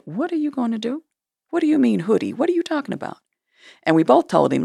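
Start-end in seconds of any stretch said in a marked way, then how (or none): 0.1-3.2 higher, mock-bewildered boyfriend voice; imitating new boyfriend. Nosy boyfriend butting into the women's plan, higher mock-bewildered voice. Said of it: "the new boyfriend overheard us and said".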